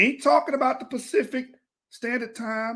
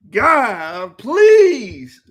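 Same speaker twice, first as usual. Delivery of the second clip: exasperated plea; 10 dB louder than usual. Real, not acted. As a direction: cry out for mercy, half laughing. Exasperated plea over mispronunciation.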